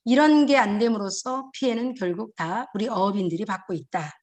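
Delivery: ordinary talk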